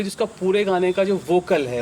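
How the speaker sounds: normal talking voice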